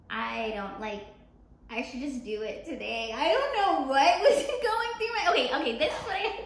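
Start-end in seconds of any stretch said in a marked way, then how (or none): 3.1-6.5 laughing. Real, not acted. Laughing at own story.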